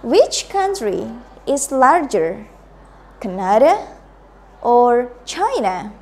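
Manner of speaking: slow, with "Canada" rising and "China" falling; slow choice-question intonation. Shown as a demonstration. Teacher modelling for the class, slow rise then fall. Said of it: "Please repeat".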